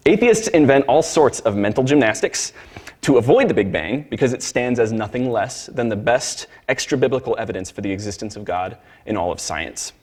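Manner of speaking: ordinary talk, nothing marked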